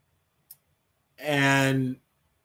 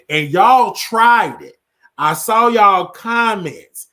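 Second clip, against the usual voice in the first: loud, higher and drawn out; emphatic call-out; 6 semitones higher, 9 dB louder, slower than usual. Real, not acted. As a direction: call them out, stretching the words loudly. Emphatically calling out viewers' comments.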